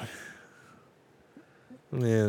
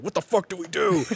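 higher-pitched exasperated outburst; mock panic; 6 semitones higher than usual. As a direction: play writers at a total loss. Acted panicked reaction of show writers.

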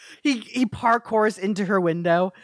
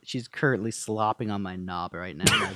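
much lower, softer growl of a boast; low taunting voice; 10 semitones lower, 4 dB quieter than usual. Acted. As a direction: gloat down low into the phone.

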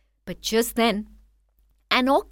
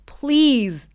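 begging; 5 semitones higher, 7 dB louder than usual. Acted. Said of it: "begged the man".

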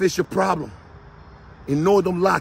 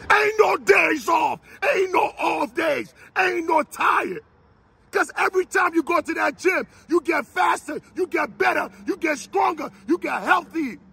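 fiery preaching; 8 semitones higher than usual. Acted. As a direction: drive it home like a coach at full force. Preaches at full force.

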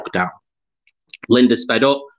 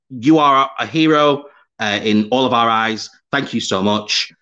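usual speaking voice

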